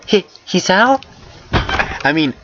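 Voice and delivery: high-pitched, questioning greeting; high, tentative-sounding hello; girl character voice. A narrator's reading. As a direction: put on a high voice, rising at the end. Voices shy girl in story.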